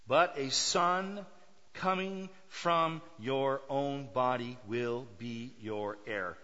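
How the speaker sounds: "But a son coming from your own body" very slow, word by word; strong, deliberate. Acted. God promising Abraham a son, deliberate weight on each word through "your heir". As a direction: set down each word of "will be your heir" firmly and slowly. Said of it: "God is being very strong here".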